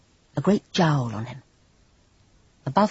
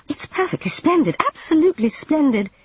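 high, gushing overpraise; too quickly; 12 semitones higher, 4 dB louder than usual. A narrator's reading. Jane hurries to praise the dead portrait, gushing. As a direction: rush the praise, too eager to please. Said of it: "too quickly".

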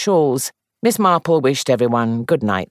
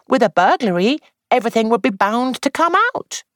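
lightly voiced, higher young actress; young woman voice; 10 semitones higher than usual. A narrator's reading. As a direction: play the actress, higher, confiding. Jane voiced high.

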